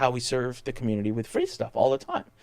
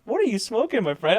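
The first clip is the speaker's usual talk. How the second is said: teasing; 8 semitones higher than usual. Retold or imitated. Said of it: "I'm like".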